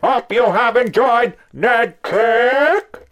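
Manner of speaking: very high, slow, loud synthetic voice; robotic announcer. Pretend. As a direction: announce it like a machine. Mock announcer sign-off.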